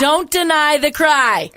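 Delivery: loud, slow, drawn-out slogan; emphatic slogan. Real, not acted. Telling the listener, a loud slow slogan. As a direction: preach it like a motto. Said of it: "Are you listening, baby?".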